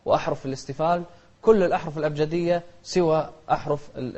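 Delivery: ordinary talk, nothing marked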